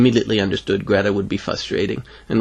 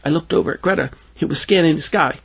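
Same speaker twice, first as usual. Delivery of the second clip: plain reading voice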